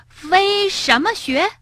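high, drawn-out baffled question; puzzled question. A narrator's reading. Rabbit and frog question the flying doctors, puzzled. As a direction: ask in bafflement, high and stretched. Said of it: "异口同声地问道".

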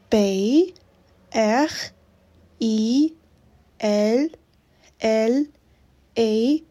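letter by letter: B, R, I, L, L, E; spelling it out. Shown as a demonstration. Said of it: "Können Sie das bitte buchstabieren?".

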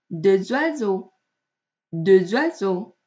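DES OISEAUX slowly linked as "dé-zoiseaux"; liaison with Z. Shown as a demonstration. Teacher gives another example, Z linking DES OISEAUX. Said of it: "它都是发成了Z的音".

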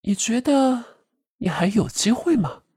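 high taunting doll voice; mocking. A narrator's reading. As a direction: sneer the question at her. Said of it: "人偶嘲弄地笑道". (嘲弄地)

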